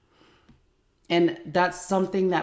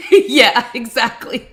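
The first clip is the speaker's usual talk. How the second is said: real laugh at the son's huff; 7 semitones higher, 13 dB louder than usual. Real, not acted. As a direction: laugh right through the yeah, much louder. Laughing at son's slammed door.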